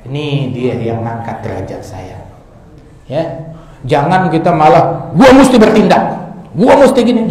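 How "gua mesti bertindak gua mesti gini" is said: louder, higher hothead voice; husband boasting he will act, loud and high; mock hothead. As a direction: puff up like a rash husband. Mocks hasty husband.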